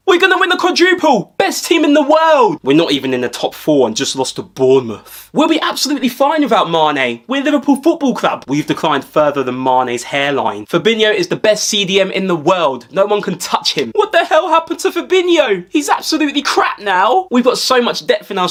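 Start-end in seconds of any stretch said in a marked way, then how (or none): 0.1-2.6 high, loud deluded boasting; boastful fan cheer. Acted. 14.0-17.3 outraged fan. Acted. Fan turning on player.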